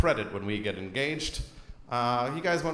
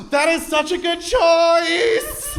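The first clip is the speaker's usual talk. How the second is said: high, slow, loud gushing praise; gushing clerk; 11 semitones higher, 6 dB louder, much slower than usual. Retold or imitated. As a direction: swoon over his choice. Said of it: "she's like, gasps".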